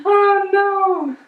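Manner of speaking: high, loud dismayed oh no. Real, not acted. Seeing Marie Curie come up, a high dismayed cry. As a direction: cry out in mock horror.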